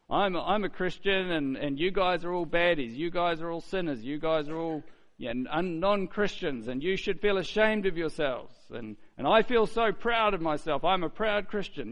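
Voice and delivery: high, loud smug bragging voice. Retold or imitated. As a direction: sneer it like a proud show-off believer.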